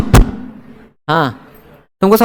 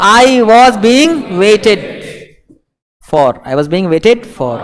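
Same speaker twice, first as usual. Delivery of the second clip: slow dictation; much slower than usual. Shown as a demonstration. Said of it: "teacher dictates passive sentence".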